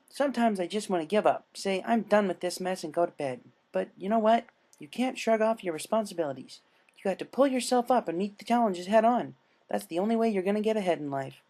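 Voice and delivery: chirpy life advice from a madwoman; Pinkie lecturing. Acted. Pinkie lectures the captive Dash, high and preachy.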